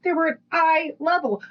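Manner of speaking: high, exasperated imitation of a partner; indignant protest. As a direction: protest in a high mock-outraged voice. Partner's protest in retold quarrel.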